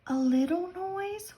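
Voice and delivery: high, small rabbit question; whisper. Acted. Rabbit wondering aloud, high and small. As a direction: ask it high and tiny. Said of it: "whispered the rabbit".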